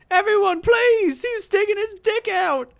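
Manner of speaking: very high, loud shrieking; shrill false screaming. Acted. Girl screams false accusations, shrill and loud. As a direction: scream to wake the street.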